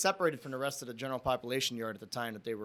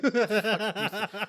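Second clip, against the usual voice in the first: laughing; 7 semitones higher, 3 dB louder than usual. Real, not acted. Retelling his own annoyed thought.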